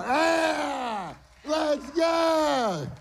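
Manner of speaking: high, drawn-out wrestler's holler; hyped-up shout. Pretend. Pastor starting a mock wrestling match, high hyped-up yell. Being pinned on stage, hyping up.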